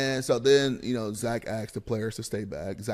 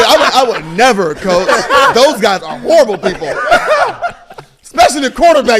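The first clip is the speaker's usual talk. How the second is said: higher, much louder, laughing "I would never, coach"; hammed-up snitching, shouted through laughter; mock pleading; 10 semitones higher, 16 dB louder than usual. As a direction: beg the coach while cracking up.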